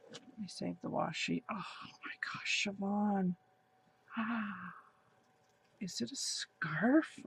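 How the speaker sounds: breathless whispered awe; awed whisper. Real, not acted. Whispering in awe at a friend's gift.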